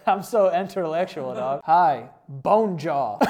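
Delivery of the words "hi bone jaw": "bone jaw" said slow as two flat English words, BONE stressed; mock mispronunciation. Reading a texter's mangled French greeting aloud, slow "bone jaw". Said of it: "the American way to say that is bone jaw".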